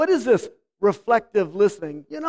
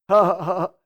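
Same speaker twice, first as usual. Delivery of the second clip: hammed-up ha-ha laugh; mock laugh at own joke; 3 semitones lower, 3 dB louder, faster than usual. Husband laughing at his own joke to his wife, a hammed-up ha-ha. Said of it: "it's like".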